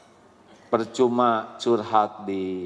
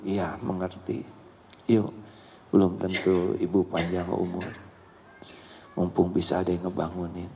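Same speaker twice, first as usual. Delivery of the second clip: soft coaxing; 5 semitones lower, 4 dB quieter than usual. Acted. Mother coaxing child softly.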